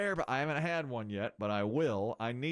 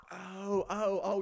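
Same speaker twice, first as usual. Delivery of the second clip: higher, rising oh oh oh; dawning realization; 7 semitones higher, much slower than usual. Retold or imitated. Host realizing his holiday slip, surprised. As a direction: let it dawn on you. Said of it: "it finally dawned on him".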